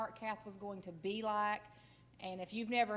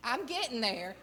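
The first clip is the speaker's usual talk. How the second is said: normal talking voice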